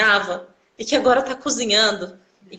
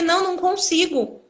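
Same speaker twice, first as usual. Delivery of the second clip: high-pitched helpless refusal; retold tearful protest; 8 semitones higher than usual. Patient to physiotherapist who says get up, tearful. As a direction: imitate yourself pleading through tears. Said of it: "Eu comecei a chorar, falei".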